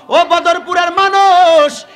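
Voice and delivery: loud, high, drawn-out call to the crowd. Real, not acted. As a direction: hail the whole village, stretching the last word. Calling out to the village crowd. (উঁচু গলায় টেনে জনতাকে ডাক)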